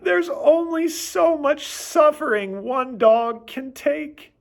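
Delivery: high, loud hammed-up moaning; dramatic moan. Hungry dog on his back moaning at his humans, hammed-up high moan. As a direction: moan like a dying dog for pity. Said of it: "roll onto my back and moan".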